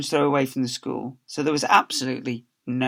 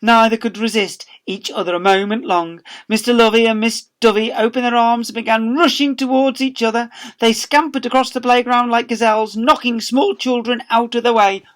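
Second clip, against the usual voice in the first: excited build; 9 semitones higher, 6 dB louder than usual. A narrator's reading. Narrator excited rush to embrace.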